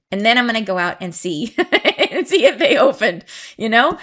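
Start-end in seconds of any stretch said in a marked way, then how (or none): 1.6-4.0 laughing. Real, not acted. Giggling about her peonies.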